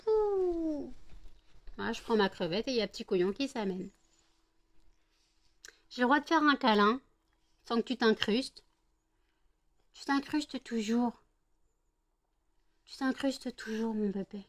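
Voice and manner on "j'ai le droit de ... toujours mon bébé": pet talk to dog. Real, not acted. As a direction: coo at a pushy puppy. Cuddling dog, dog interrupts.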